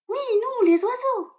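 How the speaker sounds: very high chirpy bird voice; little robin voice. A narrator's reading. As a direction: voice a tiny robin proudly. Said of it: "précise le rouge-gorge".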